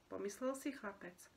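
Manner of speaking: ordinary delivery, nothing marked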